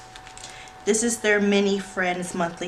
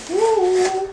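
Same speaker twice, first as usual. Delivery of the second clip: excited whoop; 12 semitones higher, 7 dB louder than usual. Real, not acted. Cheering at box reveal.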